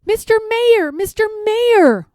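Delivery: high, carrying call to someone far away. Acted. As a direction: call down to the tiny mayor. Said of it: "Horton called".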